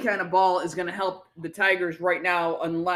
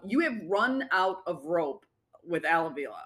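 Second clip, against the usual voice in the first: ordinary delivery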